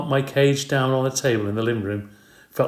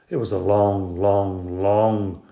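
drawn out; slower than usual. A narrator's reading. Stretched long ride.

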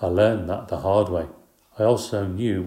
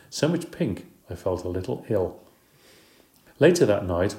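ordinary delivery, nothing marked

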